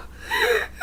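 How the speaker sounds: sudden high breathless laugh; a short laugh at her own misery; high breathy laugh. Real, not acted. Bitter laugh at own joke.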